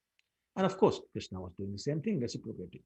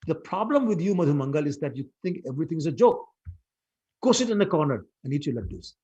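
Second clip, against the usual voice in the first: louder, higher furious scolding; mother yelling at Madhumangal to sit down; angry yell; 4 semitones higher, 7 dB louder than usual. Acted. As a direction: yell her frustration at the joker. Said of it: "yelled at him again".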